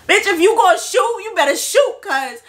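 high-pitched defiant challenge; retold defiant yelling. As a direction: act out standing up to him, pitched well up. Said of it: "I start yelling at him".

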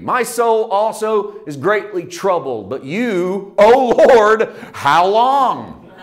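loud, high, slow lament; anguished plea. Acted. Psalmist pleading with God in anguish. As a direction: wail the question how long. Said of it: "Look at Psalm six three".

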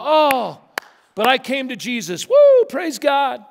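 milk the big conversion moment; hammed-up testimony. Preacher mocking a dramatic prodigal testimony ending in loud praise. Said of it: "I was this and I was that".

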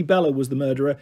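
plain reading voice

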